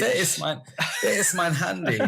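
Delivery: mock camp German accent; super camp German. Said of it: "super camp German".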